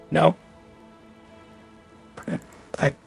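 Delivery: ordinary talk, nothing marked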